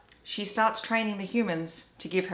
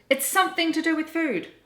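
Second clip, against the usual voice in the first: high, loud sudden exclamation; shouting; 10 semitones higher, 5 dB louder than usual. Acted. Grandma Poss shouting her sudden idea to Hush, loud. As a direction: blurt out the idea at full volume. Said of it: "she shouted".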